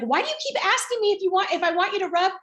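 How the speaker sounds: high-pitched, fast, exasperated retold snap; snapped. Mother retelling how she snapped at her daughter, high irritated snap. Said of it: "I kinda snapped at her".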